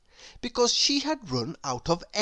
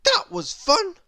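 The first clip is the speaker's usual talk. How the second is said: higher, louder, falsely bright; stubborn girl, pretending; 13 semitones higher, 5 dB louder than usual. A narrator's reading. Little Miss Stubborn pretends the fall was fun, high and bright. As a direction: brush it off brightly. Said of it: "said little Miss Stubborn".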